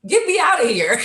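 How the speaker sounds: put-on a toddler begging to be taken home; whiny little girl. Child at daycare begging her daddy, high and whiny.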